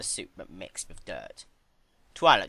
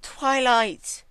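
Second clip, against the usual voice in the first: high loud sobbing cry of the name; 9 dB louder than usual. Acted. Celestia wailing at Twilight, sobbing. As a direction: wail the name in tears. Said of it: "descended into full-blown crying".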